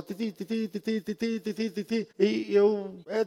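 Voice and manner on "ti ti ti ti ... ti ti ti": soft, high "ti ti ti" mimicking a ringtone; a hushed ringtone impression; phone ringing imitation. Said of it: "que tava tocando o celular".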